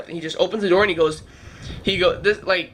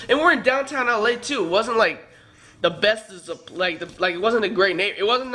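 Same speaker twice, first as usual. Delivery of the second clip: ordinary talk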